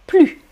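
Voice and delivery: "plus" as plu, ending on the vowel; teacher's crisp demo of "plus" as plu; silent final S. Shown as a demonstration. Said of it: "R, une consonne".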